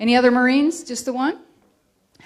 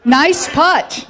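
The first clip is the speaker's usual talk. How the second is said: laughing mock call; joking golf praise; 7 semitones higher, 7 dB louder than usual. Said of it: "What does the Air Force say".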